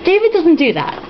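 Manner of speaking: ordinary talk, nothing marked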